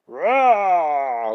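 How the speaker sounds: loud roar; monster roar. Acted. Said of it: "rufen sie". (Monster brüllen laut)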